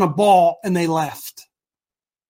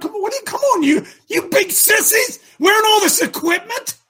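high mocking jeer at the big sissies; taunting; 10 semitones higher than usual. David jeering at the armored soldiers, high and mocking.

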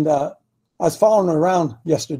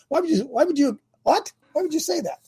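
incredulous questioning; 7 semitones higher, 6 dB quieter, faster than usual. Real, not acted. Challenges the negative remark just acted.

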